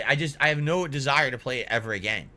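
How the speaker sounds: ordinary talk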